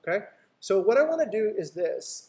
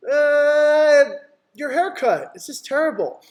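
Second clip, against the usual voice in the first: high, loud mock client; hammed-up client insult; mock client complaint; 8 semitones higher, 8 dB louder, slower than usual. Client mocking the seller's haircut, high and loud.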